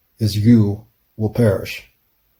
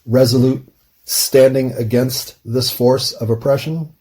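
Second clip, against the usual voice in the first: ordinary talk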